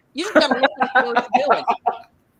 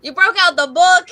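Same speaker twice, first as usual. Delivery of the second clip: excited; 6 semitones higher, 10 dB louder than usual. Real, not acted. Teasing friend about reading.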